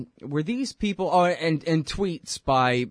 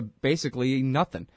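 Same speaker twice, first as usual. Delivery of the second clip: ordinary delivery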